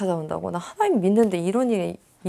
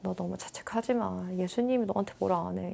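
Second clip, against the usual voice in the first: gentle comfort; 9 dB quieter than usual. Retold or imitated. Comforting a reader while patting.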